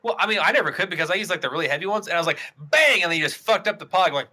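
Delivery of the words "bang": loud, high burst of impact; loud slam noise. Retold or imitated. Friend mimics a heavy Pog slammer hitting, loud bang. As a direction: punch it out like a slam.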